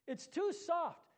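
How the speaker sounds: a light sigh of doubt. A narrator's reading. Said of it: "the angel sighed".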